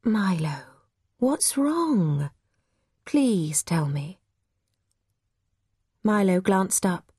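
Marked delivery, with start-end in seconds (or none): 0.0-4.2 whisper, then slow hushed plea; gentle coaxing. A narrator's reading. Mother gently pleading with her son.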